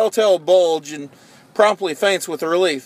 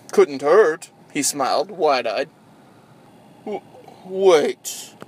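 usual speaking voice

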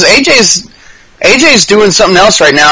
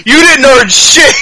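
slow, higher, half-laughing jeer; mock taunt; 6 semitones higher, slower than usual. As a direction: taunt him, laughing as you say it. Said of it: "and be like".

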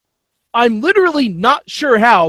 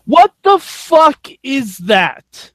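slow, high exclamation of disbelief; drawn-out, incredulous outburst; outraged shout; 5 semitones higher, much slower than usual. Acted. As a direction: shout it out slowly, word by word. Mock outrage at co-general managers.

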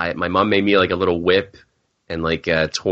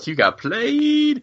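slow, much higher teasing sing-song; mocking; much slower than usual. Real, not acted. Cohost teasing the host who got scammed, slow and high. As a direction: drag it out to rub it in.

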